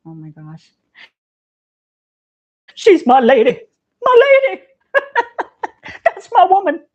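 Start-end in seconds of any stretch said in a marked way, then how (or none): 2.8-4.6 very high, loud put-on voice breaking into laughter; laughing proud cry. Host laughingly re-enacts the actress's proud outburst for Septina. 4.9-6.0 squealing laugh up high; high giggle. Real, not acted. Host cracking up at her own impression. 6.0-6.8 very high, loud put-on voice breaking into laughter; laughing proud cry. Host laughingly re-enacts the actress's proud outburst for Septina.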